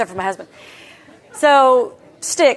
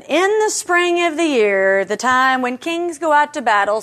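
slow, much higher, grand opening; dramatic scripture reading; 4 semitones higher than usual. Acted. Reading Second Samuel aloud to the class, slow and grand. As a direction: read it like a solemn proclamation.

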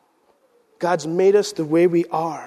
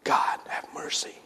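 breathy hushed prayer; whispered mercy; whispered plea; 12 dB quieter than usual. Real, not acted.

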